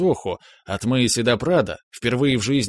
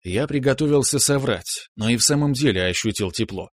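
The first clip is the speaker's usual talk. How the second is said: usual speaking voice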